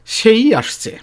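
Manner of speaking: emphatic সেই in "সেই আসছে"; stress on সেই. Shown as a demonstration. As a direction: hit সেই hard to say it is him. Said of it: "আবার জোর দিয়েছে".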